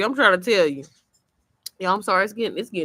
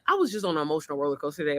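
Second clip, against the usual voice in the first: ordinary talk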